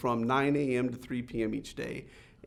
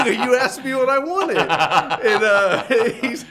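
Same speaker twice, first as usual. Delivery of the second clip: high, loud laughter running through the words; cracking up while retelling; laughing; 9 semitones higher, 12 dB louder, slower than usual. Real, not acted. Speaker laughs at his own boldness with the owner, laughing.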